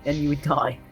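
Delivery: usual speaking voice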